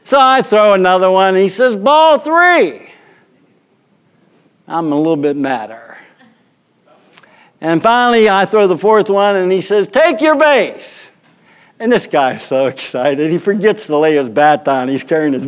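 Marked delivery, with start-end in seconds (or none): 1.8-2.9 high, ringing mock umpire call; umpire's call. Umpire calls another bad pitch on the pitcher, mock call. 9.9-11.1 high, drawn-out mock umpire call; umpire's call. Umpire sends the old batter to first, drawn-out mock call.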